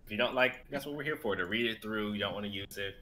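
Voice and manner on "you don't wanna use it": ordinary talk, nothing marked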